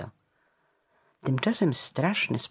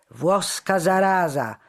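high, loud angry insult; heckler's hostile outcry; shouting; 8 semitones higher, 7 dB louder than usual. A narrator's reading. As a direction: yell abuse at the prisoners. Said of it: "wykrzykiwał".

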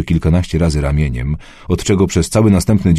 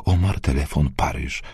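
ciche, ledwo wymamrotane słowa; mamrotanie; 3 semitones lower, 6 dB quieter than usual. A narrator's reading. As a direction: wymamrocz to cicho, półprzytomnie, w zamroczeniu. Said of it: "Wymamrotała kilka słów". (ciche, półprzytomne mamrotanie)

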